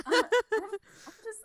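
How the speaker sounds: real giggles through the words; giggling. Real, not acted. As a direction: laugh helplessly at yourself. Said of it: "I don't even understand why I'm like this".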